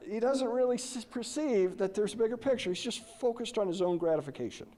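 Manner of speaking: ordinary delivery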